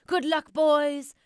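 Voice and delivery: loud, slow farewell call; loud slow send-off cry; cried out. A narrator's reading. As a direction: call after them as they leave. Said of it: "he cried".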